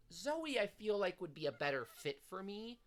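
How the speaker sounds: normal talking voice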